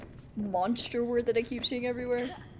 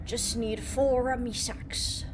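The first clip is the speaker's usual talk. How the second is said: slow pirate voice; pirate accent; slower than usual. Pretend. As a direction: growl it like a sailor.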